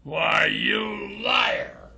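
high, drawn-out strained shout; outraged accusation. Acted. Friend accuses hero of lying, high outraged cry.

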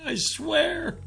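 high, soft tearful pleading; sobbing. A narrator's reading. Young captive swears innocence to his father, sobbing. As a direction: plead in a small crying voice. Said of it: "The young man sobbed".

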